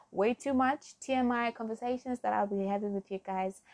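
ordinary delivery

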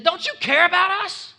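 high, loud panicked plea to a sleeping master; desperate plea. Retold or imitated. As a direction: cry out in fear over the storm. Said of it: "They said".

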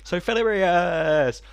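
high, slow silly voice; funny voice. Pretend. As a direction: stretch out hilarious playfully. Mimicking the phrase.

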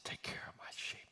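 very soft, whispered instruction; whispered charge. Acted. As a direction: entrust it in a hushed whisper. Jesus answers Peter intimately.

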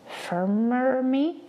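slow, rising puzzled echo; puzzled question. Acted. Red Wolf puzzling over a new word, puzzled. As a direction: repeat the strange word unsure.

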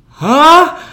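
loud, high yelp of disbelief; exaggerated, caught-off-guard squeak; startled question. Food runner asked by server about age, startled.

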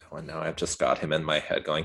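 ordinary talk, nothing marked

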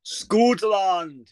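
loud Scottish accent, falling at the end. Shown as a demonstration. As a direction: proclaim it like a Scot. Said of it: "like, Scottish accent".

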